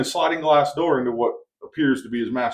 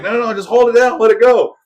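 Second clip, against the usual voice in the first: much higher, louder, eager push to keep firing; eager urging; 12 semitones higher, 10 dB louder than usual. Retold or imitated. The host urging his guest to keep firing, eager and loud. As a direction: egg him on to hold the trigger.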